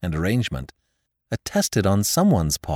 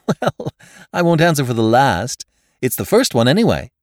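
put-on laughing young man; 5 semitones higher, 6 dB louder than usual. Nephew laughing at his will.

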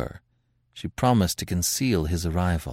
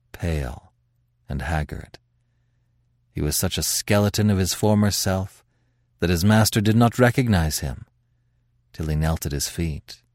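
ordinary delivery, nothing marked

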